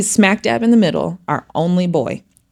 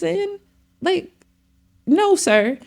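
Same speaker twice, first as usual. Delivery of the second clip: mock stern dad; 11 semitones higher, slower than usual. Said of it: "he'll get on him real bad".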